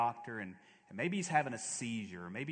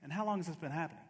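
doctor's question; 3 semitones higher, 3 dB quieter, much faster than usual. Retold or imitated. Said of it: "they all say".

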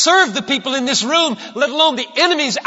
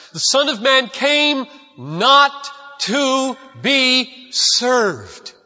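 slow emphasis; 2 semitones higher, much slower than usual. Acted. Preacher's key line, drawn out.

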